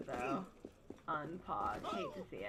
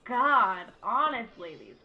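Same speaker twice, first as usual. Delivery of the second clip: annoyed; 9 dB louder, slower than usual. Real, not acted. Pushing through a game crowd.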